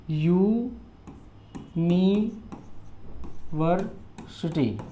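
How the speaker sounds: "University" very slow and soft, in separate syllables. Shown as a demonstration. Teacher showing the starting sound, "university" drawn out by syllables. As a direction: stretch out यू, नि, वर, city in "university". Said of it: "university य से उच्चारण हो रहा है".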